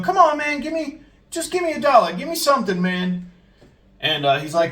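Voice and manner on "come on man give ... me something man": high-pitched mock pleading, pushing on and on; imitated persistent begging. Panhandler keeps pressing the manager, high insistent imitation. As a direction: badger for money in a raised put-on pitch. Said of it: "he persists. He keeps going".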